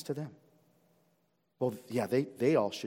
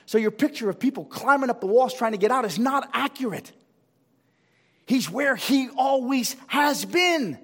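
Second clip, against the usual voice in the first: preaching at full force; 13 semitones higher, 10 dB louder than usual. Acted. Pastor preaching hell's meaning.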